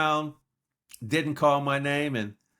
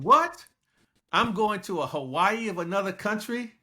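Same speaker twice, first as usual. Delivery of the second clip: high-pitched incredulous "what", then raised questioning tone; retold astonishment; 6 semitones higher than usual. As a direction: act out amazed disbelief. Retold astonished thought at the offer.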